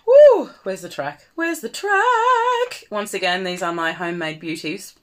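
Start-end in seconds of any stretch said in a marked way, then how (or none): 0.0-0.5 loud high woo; excited whoop. Real, not acted. Pumping herself up. 1.4-2.8 high, slow sing-song Where's the track; Anna voice. Pretend. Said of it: "I'm being method".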